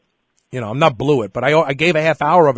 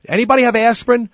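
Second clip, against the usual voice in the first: urgent call out; 7 semitones higher, 4 dB louder than usual. Retold or imitated. Running into daycare asking for aspirin.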